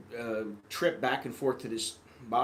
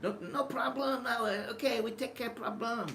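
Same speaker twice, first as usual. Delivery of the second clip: mock Thai English; 10 semitones higher than usual. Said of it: "it's like".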